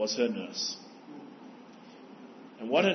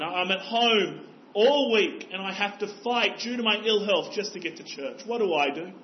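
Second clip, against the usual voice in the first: put-on upset old lady; 5 semitones higher, 4 dB louder than usual. Old lady voiced in story.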